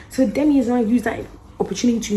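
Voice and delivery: normal talking voice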